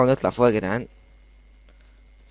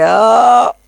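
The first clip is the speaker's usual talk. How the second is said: loud high cry of frustration; frustrated shout; 9 semitones higher, 7 dB louder, much slower than usual. Real, not acted. Worn-out gamer yells in frustration after falling. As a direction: let out an exasperated yell. Exhausted after long climb.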